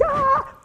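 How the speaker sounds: short, very high-pitched "ah!"; comic scream. Acted. Eight-hour session squeezed short, mock panicked squeal. Mimes frantic feeling of rushed session.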